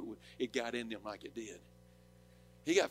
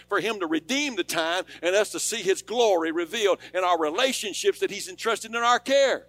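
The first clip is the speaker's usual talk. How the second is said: rising shouted preaching, louder and higher with each line; full-force preaching; 7 semitones higher, 12 dB louder than usual. Acted. Preacher drives the enemy out before the congregation, shouting. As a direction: build the sermon to a roaring climax.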